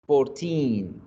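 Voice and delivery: put-on "Fourteen" with the final -teen stressed and held. Shown as a demonstration. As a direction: draw out the last syllable of FOURTEEN. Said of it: "Ahí porque les estaba acentuando". ("Fourteen" con acento en -teen)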